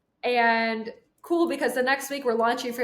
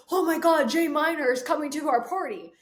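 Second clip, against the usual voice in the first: excited news; 5 semitones higher than usual. Retold or imitated. Josh telling everyone in the Suburban, excited. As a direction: announce it like breaking news.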